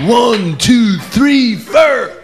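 high mock rock-band count, "four" as FUR; hammed-up count-off, "four" rhyming with fur; shouted count-in.

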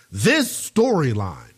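"storyline" drawn out slowly for emphasis; stress on storyline. Real, not acted. Host flags a key phrase for listeners, slow emphasis on "storyline". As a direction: lean slowly and heavily on "storyline". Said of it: "emphasis on that word".

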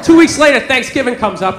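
ordinary delivery

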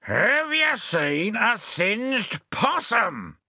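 high, drawn-out roar; exasperated roar, pitched high and stretched; roared question. A narrator's reading. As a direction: roar each word out slowly. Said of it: "roared Bill".

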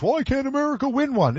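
light impression of a baffled American fan; mock wondering fan. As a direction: mimic everyone asking, half-mocking. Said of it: "Everyone wonders".

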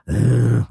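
low zombie moan; zombie groan. Acted. Imitating poll workers staring at you, low zombie groan. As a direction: groan like the living dead.

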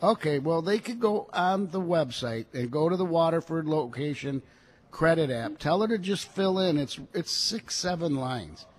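usual speaking voice

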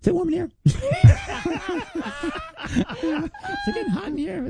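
high, laughing mock fluster; laughing tease. Host teasing the studio after a racy reply, giggling high. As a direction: fan yourself, giggling, pretend to overheat.

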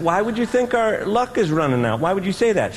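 high-pitched, baffled questioning; incredulous husband. Retold or imitated. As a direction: question it in high, mock disbelief. Husband questions wife's worry.

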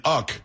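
"Uck" as a single syllable for UK; jokey mispronunciation. Pretend. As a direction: say UK as one word, "Uck". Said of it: "maybe because I say Uck".

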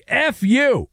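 high-pitched mock yell; light, exaggerated yell in quotation; retold yell. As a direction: imitate a yelling official, pitch up, volume held. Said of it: "the official yelling".

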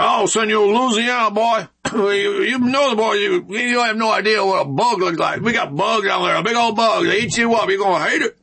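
mock Southern recruiter warning about the bugs. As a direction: play a folksy Louisiana recruiter teasing the boy. Louisiana recruiter voiced in story.

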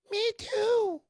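high squeaky little-man voice; tiny voice. Retold or imitated. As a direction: pipe up like a tiny man at the counter. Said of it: "Me too is locked out".

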